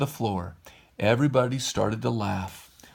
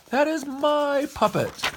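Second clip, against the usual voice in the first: high, slow, strained villain voice; angry puppet master; slower than usual. Acted. Stromboli claims Pinocchio as his own, high and slow. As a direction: drag it out, possessive and high.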